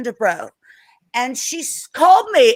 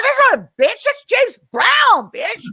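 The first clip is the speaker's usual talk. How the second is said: mock indignant shout; 11 semitones higher, 3 dB louder than usual. Defending [name] to friends, indignant shout. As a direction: correct them loudly, almost shouting. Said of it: "I'm like".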